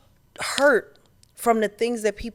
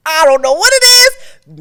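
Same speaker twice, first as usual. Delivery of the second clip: much higher and louder, a put-on old man's exclaim; grandfather in me; 10 semitones higher, 16 dB louder than usual. Explaining why she never crashed out, grandfather voice. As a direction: let the grandfather burst out. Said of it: "Maybe it's the grandfather in me".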